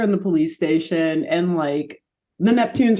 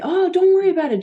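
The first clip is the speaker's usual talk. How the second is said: much higher sing-song reassurance; Shelly reassuring; 11 semitones higher, much faster than usual. Retold or imitated. Shelly comforting Jeff before his makeover, higher reassuring voice. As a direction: soothe a nervous friend brightly. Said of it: "Jabberjaw and Shelly say".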